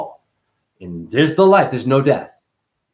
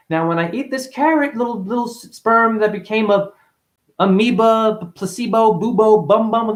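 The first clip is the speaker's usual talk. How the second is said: mocking science talk; 7 semitones higher, 3 dB louder than usual. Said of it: "I'm not saying".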